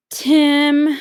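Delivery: loud, high exclaim. A narrator's reading. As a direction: call out his name together. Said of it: "exclaimed Jim's family".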